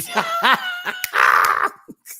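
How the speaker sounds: loud, high burst of laughter; real cackle. Real, not acted. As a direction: shriek with laughter. Laughing at own imitation of mom.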